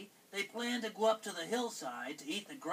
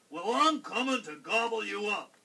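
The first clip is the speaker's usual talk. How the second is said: loud troll threat; booming troll voice; menacing troll; 3 semitones higher, 8 dB louder than usual. A narrator's reading. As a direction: threaten loudly as a hungry storybook troll. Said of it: "said the troll".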